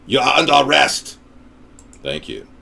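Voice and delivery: monotone robotic police order; robot cop. Pretend. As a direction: announce it like a machine.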